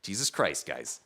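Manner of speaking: low, soft exasperated dad; a sighing father; weary dad. Retold or imitated. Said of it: "My dad just being like".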